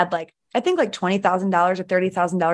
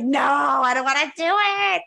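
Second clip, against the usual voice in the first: put-on much higher, childlike whine of protest; whiny refusal; 8 semitones higher, slower than usual. Her inner voice resisting a belief shift, whiny childlike protest. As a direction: whine it like a stubborn kid refusing.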